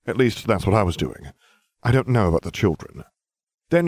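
usual speaking voice

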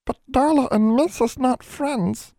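high, slow elf squeak; small creature objecting in a drawn-out squeak; tiny voice. A narrator's reading. Nat doubts the girl's promise, tiny high voice. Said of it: "in a tiny voice".

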